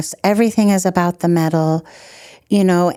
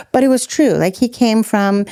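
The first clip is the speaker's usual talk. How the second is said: ordinary delivery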